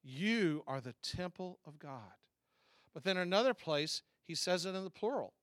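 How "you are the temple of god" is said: slow reading with weight on "You"; individual address. Acted. Paul speaking to believers individually, "You" slow and stressed. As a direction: stretch "You", then measured to the end. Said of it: "he says to us individually".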